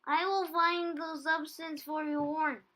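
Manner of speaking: high, loud chirpy little voice; Teeny's voice. A narrator's reading. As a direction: squeak it out brightly. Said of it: "Teeny said".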